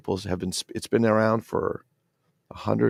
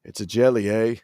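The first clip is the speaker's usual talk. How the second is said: mock Canadian accent; 4 dB louder than usual. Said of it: "Strange Brew with".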